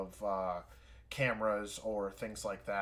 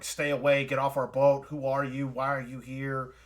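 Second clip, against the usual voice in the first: loud, lightly acted warning shouts; mock yelling; 2 semitones higher, 7 dB louder than usual. People behind the door warning off an intruder, mock yelling. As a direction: shout them away from the boat, half in earnest. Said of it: "they're just basically yelling".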